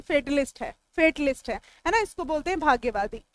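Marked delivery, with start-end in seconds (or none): 0.0-0.8 फेटलिस्ट with a long "fe" as in fate, falling tune; correct pronunciation. Shown as a demonstration. Teacher correcting students' pronunciation, firm falling fate-vowel. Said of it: "इसका pronunciation फैटलिस्ट नहीं". 1.0-1.6 फेटलिस्ट with a long "fe" as in fate, falling tune; correct pronunciation. Shown as a demonstration. Teacher correcting students' pronunciation, firm falling fate-vowel. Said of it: "इसका pronunciation फैटलिस्ट नहीं".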